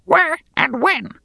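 high, slow barking goblin. Acted. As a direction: bark the question like a goblin. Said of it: "barked the goblin".

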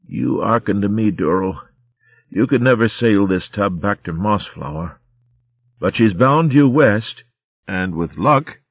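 put-on weak, rough old pirate rat; a dying corsair's last words; dying sea rat voice. Romska's advice to Durrell.

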